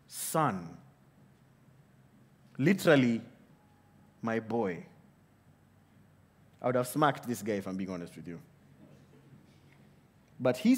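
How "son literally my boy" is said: much softer, lower, gentle address; father calming his angry elder son, very tender; tender fatherly. Retold or imitated. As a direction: speak to your son with soft love. Father tenderly answers rude son.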